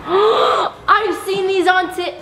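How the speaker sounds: high, slow, breaking excitement; excited squeal. Real, not acted. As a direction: squeal it out in delight. Gasp, excited exclamation.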